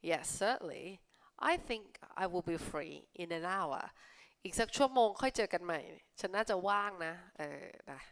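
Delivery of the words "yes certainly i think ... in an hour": slow, careful read-aloud; slow clear classroom read. Shown as a demonstration. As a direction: read each word slowly for learners. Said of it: "reads reply slowly".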